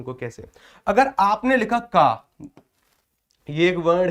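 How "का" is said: का with the aa drawn out long; long aa sound. Shown as a demonstration. Teacher to online students, demonstrating का with a drawn-out aa. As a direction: stretch का slowly to show the long vowel. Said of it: "बड़े आ की मात्रा लगाई".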